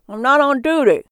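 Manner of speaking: talking through a mouthful of burger; mouth full. Acted. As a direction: cabbie chewing while he talks. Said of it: "his mouth spitting out chewed bun".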